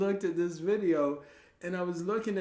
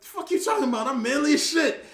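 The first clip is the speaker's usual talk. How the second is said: high and loud, offended tough-guy protest; mock indignation; 9 semitones higher, 9 dB louder than usual. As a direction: bristle like your manhood was insulted. Defending manliness, mock indignant.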